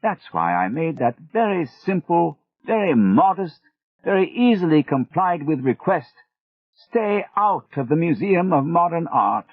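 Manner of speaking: a proud British mentor fighting back tears; Gregory, choked up, British. A narrator's reading. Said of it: "He was all choked up now".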